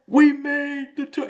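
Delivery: high, drawn-out, elated announcement; excited retelling. Retold or imitated. Roommate repeating the news, high and elated. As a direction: say it like you can't believe it. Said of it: "He's like".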